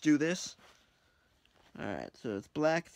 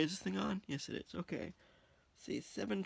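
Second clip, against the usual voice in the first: usual speaking voice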